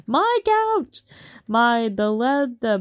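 high, loud outraged wail; wife wailing about the betrayal on her couch, loudly; outraged cry. Acted. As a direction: cry out as if the couch itself was wronged. Outraged about husband's affair.

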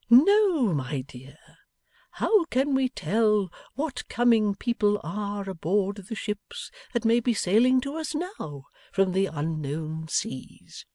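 doting Pa answering his daughter; fond father. Acted. Pa answers Bella.

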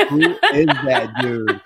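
mock your own heavy Southern accent; loud exaggerated Southern twang; thick Southern accent. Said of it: "I have this most Southernest accent".

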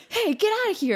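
high, loud mock shout; retold dad's yell. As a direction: yell it like an alarmed dad, hammed up. Retold dad chasing off intruder.

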